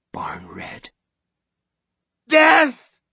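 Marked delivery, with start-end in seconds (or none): 2.3-3.0 high, loud doom-laden shriek; prophetic scream. Acted. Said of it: "Missus Carmody screamed".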